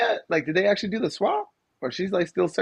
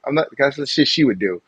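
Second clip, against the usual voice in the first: ordinary talk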